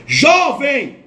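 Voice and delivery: loud retold call of jovem; woman calling to the wounded young man. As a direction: shout the word like waking someone. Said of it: "disse assim pra mim".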